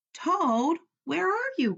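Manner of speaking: high calling voice for Frog. A narrator's reading. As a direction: call out across the dark house. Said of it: "called Frog".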